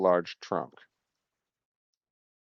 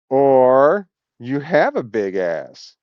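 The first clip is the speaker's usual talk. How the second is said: slow drawn out; 3 semitones higher, 5 dB louder, much slower than usual. Real, not acted. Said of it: "joking about the name".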